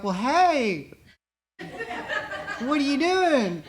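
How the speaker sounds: a dazed, delighted hello, laughing through it; giddy high greeting. Retold or imitated. Recovering addict greets the kind stranger on the phone, giddy and laughing. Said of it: "I'm sitting there like".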